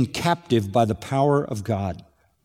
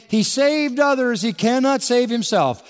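high, sneering mock; mocking quote; 10 semitones higher than usual. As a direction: sneer it like the priests. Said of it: "were mocking him".

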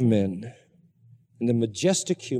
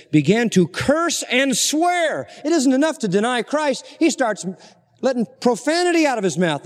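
indignant preaching; 9 semitones higher than usual. Acted. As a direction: hammer home the outrage. Preacher outraged at Peter's cursing.

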